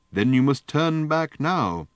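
slow, high, loud severe order. A narrator's reading. Booth lady turns away the undevout travellers, severely. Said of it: "said the little lady severely".

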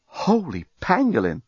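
plain reading voice

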